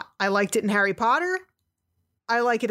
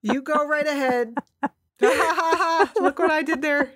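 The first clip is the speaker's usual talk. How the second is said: cracking up mid-line; 6 semitones higher, slower than usual. Real, not acted. Both laughing at the pun.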